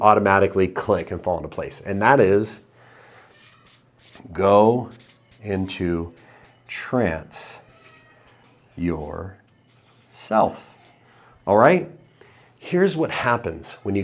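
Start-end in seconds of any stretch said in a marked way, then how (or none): 4.3-9.4 slow, soft words spaced far apart; hypnotic trance voice. Shown as a demonstration. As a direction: let each word drop low into silence. Mimics trance while naming the tip.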